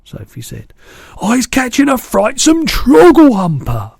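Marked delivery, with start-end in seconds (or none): 1.2-3.9 very high, loud outcry; distressed cry. A narrator's reading. BFG answering Sophie about the nightmare, high loud outcry. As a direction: cry it out in horror. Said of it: "he cried".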